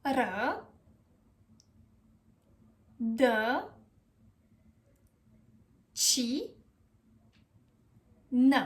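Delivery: ră, dă, ci, nă with long gaps; each piece of rădăcină alone, ci as chee; syllable by syllable. Shown as a demonstration. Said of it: "how do you pronounce it?".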